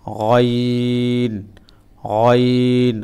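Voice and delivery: গইন drawn out slowly and heavy; thick ghain. Shown as a demonstration. Teacher demonstrating Arabic ghain, slow and thick. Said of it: "একটু মোটা করে গ".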